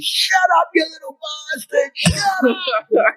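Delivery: high shrill-free mock scold from an embarrassed mum; mock scolding mother. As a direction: hiss it like a mortified mother grabbing the ear.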